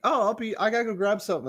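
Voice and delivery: high, rushed nervous excuse; high-pitched flustered brush-off; panicked excuse. Retold or imitated. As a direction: squeak out an excuse to escape. Fleeing awkward guest, high voice.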